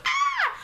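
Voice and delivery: sudden high, loud yelp; startled scream. Retold or imitated. Girl retelling being grabbed by her friend, startled shriek. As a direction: shriek like you've been grabbed. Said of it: "and I go".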